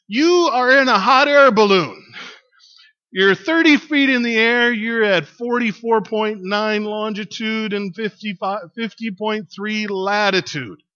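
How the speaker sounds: acted shout across a distance. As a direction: holler the facts up to a balloon, easing off slightly. Person below shouts up to balloon.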